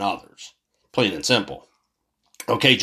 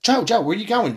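mock aide calling; 8 semitones higher than usual. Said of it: "and starts walking away".

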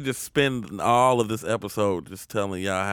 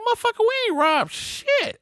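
very high-pitched squawk of disbelief; comic outraged falsetto; incredulous protest. Retold or imitated. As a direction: protest your innocence, voice shooting way up. Retold protest at robbery accusation.

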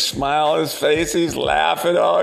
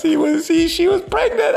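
very high, louder squeal; shrieked punchline; 5 dB louder than usual. Acted. Joke teller revealing he-she was pregnant, high squeal.

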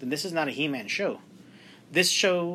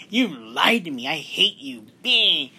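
imitated tantrum, rising to a louder last word; mock angry outburst; 3 semitones higher, 6 dB louder, slower than usual. Host mimics Tila blaming a friend for lying, mock angry. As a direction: act out a hammed-up accusation. Mocking Tila's angry reaction.

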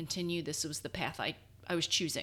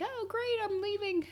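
high, sing-song mock farewell; mock teammates leaving; 11 semitones higher than usual. Teammates gloating about leaving practice, high mocking voice. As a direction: tease them like leaving teammates.

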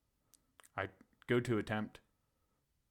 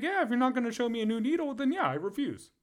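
much higher, fast, louder defiance; defiant refusal; 13 semitones higher, 5 dB louder than usual. Retold or imitated. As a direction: throw the refusal back at them.

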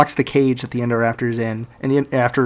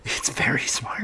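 slow hushed whisper; 9 dB quieter than usual. Acted. Telling the cohost the dinosaur is clever, slow whisper. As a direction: whisper it like a spooky secret.

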